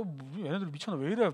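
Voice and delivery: soft, low aside to yourself; hushed acted confusion; puzzled muttering. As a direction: mutter in bewilderment at your friends. Thomas wondering outside the door.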